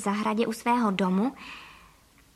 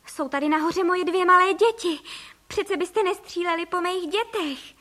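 high, anxious appeal; pleading cry; 6 semitones higher than usual. A narrator's reading. Mother protecting her two small children, pleading. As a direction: plead for the children, pitch raised. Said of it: "vykřikla paní Frejková".

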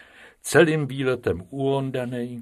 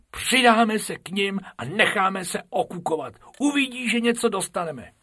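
high, eager rabbit voice; a little schemer, excited; Bobek's voice; 10 semitones higher than usual. Acted. Bobek persuading Bob to join the rabbits, eager.

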